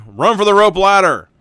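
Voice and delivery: urgent shouted order; shouting. Acted. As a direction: bark the order to flee. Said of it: "shouts Indy".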